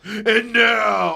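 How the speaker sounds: booming announcer call; big announcer shout. Retold or imitated. Imitating the Make-A-Wish kid turning announcer, booming shout. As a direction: belt it like a stadium announcer. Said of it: "then he's all".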